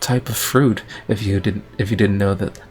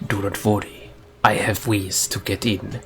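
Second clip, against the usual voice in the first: softer, badly faked Japanese-accented samurai; 2 semitones lower, 4 dB quieter than usual. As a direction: play a samurai with a mock Japanese accent, quietly. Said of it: "improve your accent of the Japanese people".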